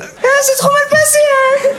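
extremely high, hammed-up squeal; client catastrophé. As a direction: squeal it like a comic wail. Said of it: "il est sorti comme ça?". (couinement suraigu exagéré)

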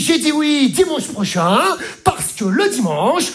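squeaky imitation of the wife justifying herself; mock wife voice. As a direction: play the wife sweetly explaining. Comedian voices wife in story.

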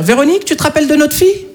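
high, fast dismayed parent; worried parent. Retold or imitated. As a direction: voice the stunned parents. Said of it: "leurs parents qui doivent se dire".

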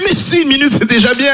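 high-pitched, urgent insistence; high caricatured squabbling voice; insistent protest. Acted. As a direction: plead it up high, agitated. Husband insists a minute is enough.

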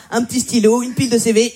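normal talking voice

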